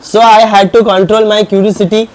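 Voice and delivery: slow, loud English read for the class; slow classroom reading. Shown as a demonstration. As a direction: read each word out slowly and clearly. Teacher reads English text aloud.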